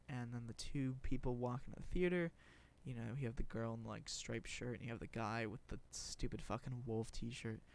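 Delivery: ordinary talk, nothing marked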